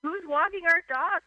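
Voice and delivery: imitated husband. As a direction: ask it exasperated. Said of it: "it's like".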